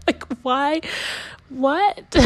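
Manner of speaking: high, rising, playful "why? What?"; mock disbelief. Laughing at her own reaction to her husband, high mock disbelief. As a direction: throw up your hands at the absurdity.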